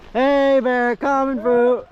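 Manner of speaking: calling out to bears. Real, not acted. Bear print spotted.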